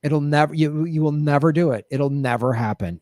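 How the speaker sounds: normal talking voice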